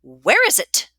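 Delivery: loud, forceful interrogation; demanding. A narrator's reading. Matron questioning accused Maddie, loud angry demand. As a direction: demand it back angrily. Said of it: "Missus Dwyer demands".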